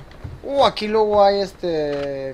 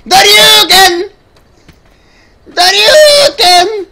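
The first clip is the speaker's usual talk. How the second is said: slow, louder, higher straining shout; drawn-out battle cry; 7 semitones higher, 6 dB louder, much slower than usual. Pretend. Gamer straining out a special move, slow shout. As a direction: wail the move name out slowly.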